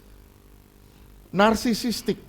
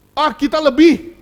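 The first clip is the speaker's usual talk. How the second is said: louder, higher dismissive brag; mock superiority; 7 semitones higher, 9 dB louder than usual. Preacher voicing a proud church, loud mock brag. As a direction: sniff down at other churches.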